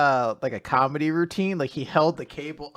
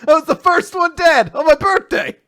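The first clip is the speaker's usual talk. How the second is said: excited outrage; 12 semitones higher, 4 dB louder than usual. Real, not acted. Excited laughing birthday complaint.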